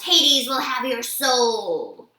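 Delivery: slow, loud monster threat; menacing promise. A narrator's reading. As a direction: growl a slow promise of doom. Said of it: "she promised".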